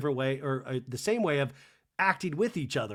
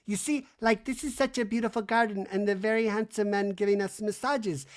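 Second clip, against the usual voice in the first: mock Jennifer; 7 semitones higher than usual. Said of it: "Jennifer goes".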